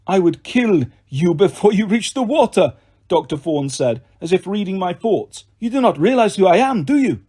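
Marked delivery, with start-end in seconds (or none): 0.0-2.7 put-on higher-pitched villain voice with a French accent; menacing French-accented villain. A narrator's reading. Doctor threatens the escaping boy, menacing French-accented villain. 5.6-7.2 put-on higher-pitched villain voice with a French accent; menacing French-accented villain. A narrator's reading. Doctor threatens the escaping boy, menacing French-accented villain.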